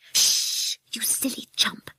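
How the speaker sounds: scolding whispered hiss. Acted. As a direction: hiss it to shut someone up. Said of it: "hissed Peggy".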